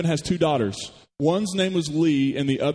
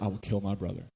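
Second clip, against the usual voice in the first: much softer, lower, a grim murmur; quiet menacing vow; 7 semitones lower, 9 dB quieter than usual. Acted. Esau vowing to himself to kill Jacob, low and quiet. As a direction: let the threat sink low and quiet. Voices Esau's murderous vow.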